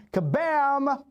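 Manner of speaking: high, stretched hype intro of the name; hype announcement. Acted. Host introducing the guest to the stream, high hyped announcer call.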